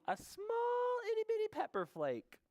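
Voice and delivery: very high, squeezed-small voice; tiny voice. A narrator's reading. Storyteller showing children a tiny flake, high tiny voice. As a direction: make it sound as tiny as a speck.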